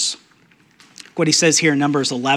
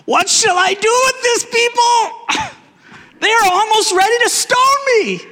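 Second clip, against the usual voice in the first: Moses crying out. Acted. Moses cries to the Lord about the people, high pleading wail. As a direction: cry out to God in a high exasperated wail. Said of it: "Moses cried to the Lord".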